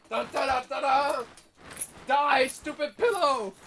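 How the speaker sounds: loud, slow hammed-up battle yell; mock battle cry. Fighting a pillow for fun, hammed-up war cry. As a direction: bellow at the pillow like a cartoon villain.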